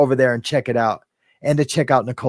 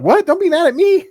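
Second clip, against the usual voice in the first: mock hurt viewer; 14 semitones higher, 5 dB louder than usual. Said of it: "She's like".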